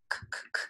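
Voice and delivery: K as three soft voiceless clicks, "k, k, k"; repeated letter sound. Shown as a demonstration. Teacher sounding out a syllable, K as soft separate bursts. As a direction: tap out the bare sound of K. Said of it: "think about those sounds".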